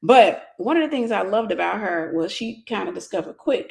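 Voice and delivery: ordinary delivery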